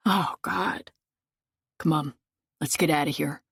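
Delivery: usual speaking voice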